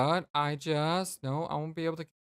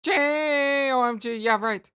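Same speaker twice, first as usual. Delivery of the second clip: high, loud yelling Roxy; higher and louder bestie yell; excited yell; 9 semitones higher, 8 dB louder than usual. Acted. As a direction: shout her name to get attention. Roxy voice peaks.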